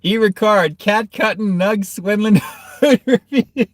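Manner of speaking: high-pitched reading, breaking into helpless laughter; real laughing fit. Real, not acted. Streamer reading a chat comment on cat cutting, laughing hard. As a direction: crack up as you read it out. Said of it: "Oh, I'm dying".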